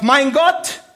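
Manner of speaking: loud high anguished cry; Jesus's cry. Retold or imitated. Preacher voicing Jesus crying out to God on the cross, loud and high. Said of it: "Immerhin schreit er noch".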